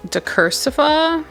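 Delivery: "decursiva" with a rising, questioning end. Real, not acted. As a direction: say "decursiva" as if unsure of it. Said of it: "I say it like a question".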